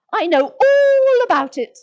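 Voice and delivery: loud, high gloating claim; boastful. Pretend. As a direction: brag that you know it all. Boasting about knowing all.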